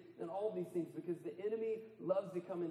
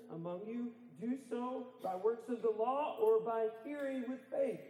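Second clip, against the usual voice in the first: raised, driving preacher voice; preaching with full force; 6 semitones higher than usual. Acted. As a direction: preach it hard to the congregation. Reading Paul's questions fervently.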